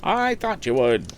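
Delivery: high, slow coaxing auction call; auctioneer coaxing. Acted. Auctioneer coaxing a silent crowd, high and slow. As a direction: ease the price down gently. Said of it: "went on the auctioneer".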